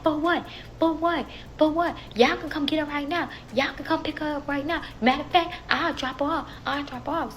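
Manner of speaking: loud, high-pitched indignant comeback; defiant retort. Retold or imitated. Baby daddy answering threat of police, imitated defiant retort.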